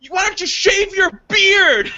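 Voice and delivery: high, slow mock screech; imitated heckling lady. Retelling the lady's comeback to him, high mocking screech. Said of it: "and she's like".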